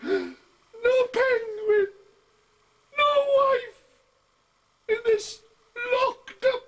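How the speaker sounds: put-on a comic old man near tears, pitched high and whiny; high wailing old codger. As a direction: wail it like a batty old seaman losing everything. Comic poem in a put-on character voice.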